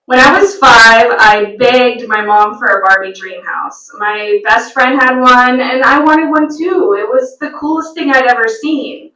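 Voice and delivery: ordinary talk, nothing marked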